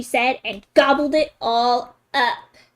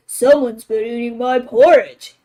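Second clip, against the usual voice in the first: great big voice; 5 dB louder than usual. Acted. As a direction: boom it out like a big papa bear. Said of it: "in his great big voice".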